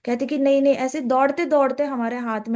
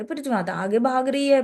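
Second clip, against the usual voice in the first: normal talking voice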